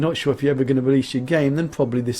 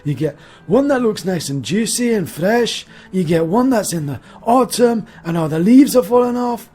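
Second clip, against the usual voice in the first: loud, higher-pitched put-on Scottish voice; mock Scottish accent; 5 semitones higher, 5 dB louder than usual. Reviewer showing off the Scots Pine, hammed-up Scottish accent. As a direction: ham up a Scottish storyteller.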